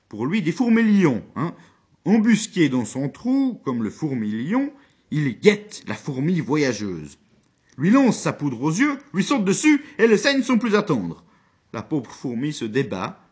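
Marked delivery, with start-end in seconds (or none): 0.1-11.2 dramatic build. Acted. Dramatic ant-lion image, loud.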